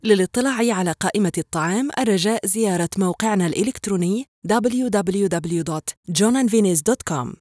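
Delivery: ordinary talk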